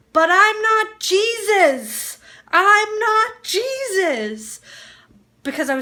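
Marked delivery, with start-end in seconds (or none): 0.1-4.6 high, loud, drawn-out mock wail; retold yelling. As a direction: re-enact a drugged, protesting yell, stretching it slowly. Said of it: "And I started yelling".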